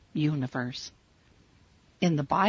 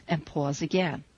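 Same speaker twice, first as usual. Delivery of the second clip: ordinary delivery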